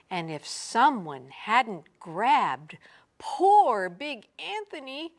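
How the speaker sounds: plain reading voice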